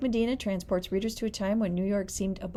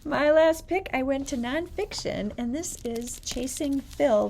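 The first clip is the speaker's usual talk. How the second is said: bright announcement; 7 semitones higher, 3 dB louder than usual. Real, not acted. Announcing final book pick.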